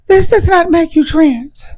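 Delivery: mock them in a high affected voice; high mocking put-on voice. Shown as a demonstration. Parodying wig wearers to the viewers, high put-on voice. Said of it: "I'm talking like this".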